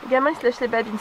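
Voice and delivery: ordinary talk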